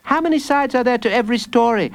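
put-on high, quick little boy; child's voice. A narrator's reading. Jacob quizzes the villain, high quick child voice. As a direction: pipe it up high and fast.

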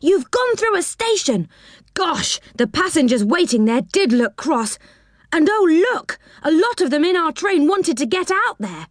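put-on high, agitated boyish exclamation; crying out. A narrator's reading. Rick tells Joe he missed a station, crying out. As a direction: exclaim it in high-pitched alarm. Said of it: "cried Rick".

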